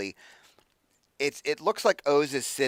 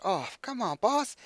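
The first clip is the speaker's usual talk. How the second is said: high, drooping whine of a let-down imp; dejected imp; 9 semitones higher than usual. Acted. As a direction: sulk like a disappointed little imp. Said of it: "he's dejected".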